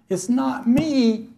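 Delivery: high, slow, tearful voice of a broken star; mimicking Marilyn Monroe in tears; tearful Marilyn quote. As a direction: let it crack high and slow as if crying. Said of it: "And she's crying".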